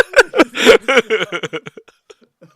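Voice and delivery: big burst of laughter; cracking up hard; loud laughter. Real, not acted. Breaks up laughing.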